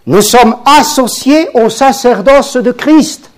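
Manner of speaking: high, loud fervent preaching; preaching at full force. Acted. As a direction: lift the congregation, build to Alléluia.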